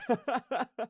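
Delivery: a quick bright burst of laughter; short laugh. Real, not acted. Streamer laughing at her own joke about the character, a short laugh.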